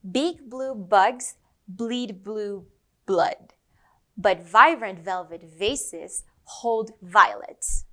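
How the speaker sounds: slow, careful tongue twister with crisp B's and V's. Shown as a demonstration. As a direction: model the twister slowly. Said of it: "try this tongue twister".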